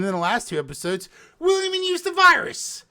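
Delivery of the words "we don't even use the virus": exasperated rant. Real, not acted. Ranting about wasted virus plot.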